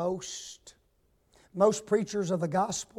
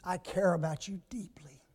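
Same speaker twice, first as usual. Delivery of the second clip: soft unvoiced whisper; 2 semitones lower, 8 dB quieter than usual. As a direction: breathe it gently to them. [name]'s words whispered.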